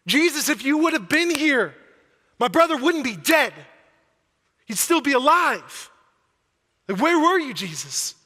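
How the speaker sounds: loud, high, anguished cry at Jesus; grieving sister shouting her accusation; angry pleading. Acted. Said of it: "she's mad at him".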